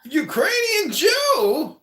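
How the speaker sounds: very high, loud, drawn-out cry; mock astonishment. Roaster echoes the guest's answer about her psychiatrist, mock astonished. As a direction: shriek it slowly in hammed-up disbelief.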